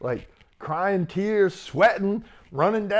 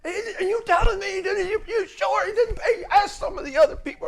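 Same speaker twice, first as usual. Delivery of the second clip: laughing disbelief; faster than usual. Retold or imitated. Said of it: "He's like".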